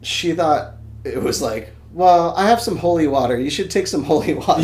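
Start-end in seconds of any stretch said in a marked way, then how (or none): 1.9-4.6 higher, louder grandmotherly offer; lightly caricatured helpful grandma, pitched up. Retold or imitated. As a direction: act out grandma pressing help on you. Voices grandma offering holy water.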